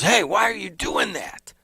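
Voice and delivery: a stomping guy calling out a question; gruff guy demanding. Retold or imitated. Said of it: "he goes".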